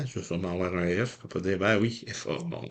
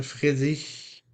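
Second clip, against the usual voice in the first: normal talking voice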